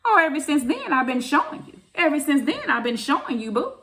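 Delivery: high, loud excited telling; rising with delight as she tells it; gleeful high retelling. Real, not acted.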